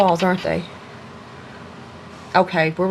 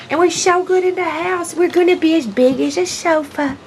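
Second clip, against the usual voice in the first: high, louder puppy voice; voicing the puppies; 9 semitones higher, 4 dB louder than usual. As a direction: talk for the dogs, higher and louder. Speaking for the puppies.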